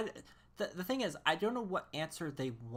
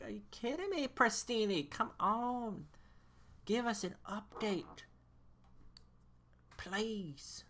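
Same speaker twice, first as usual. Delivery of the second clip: 1 mock pleading; 6 semitones higher, slower than usual. Real, not acted. Pleading with Preston for update.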